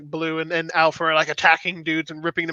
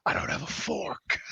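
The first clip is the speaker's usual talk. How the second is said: slow, soft, breathy deadpan; mock quip; 4 semitones lower, 8 dB quieter, slower than usual. Friend imitates the villain's dumb one-liner, slow and softer. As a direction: toss it off under the breath as a lame joke.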